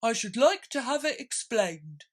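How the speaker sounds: high, loud creature voice; high loud pompous turtle; Mock Turtle voice. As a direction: turtle giving orders.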